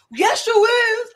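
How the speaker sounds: drawn-out, loud, high-pitched retort; stretched-out defiant reply; insistent comeback. Retold or imitated. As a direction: act out a slow sassy contradiction. Quoting own insistent comeback.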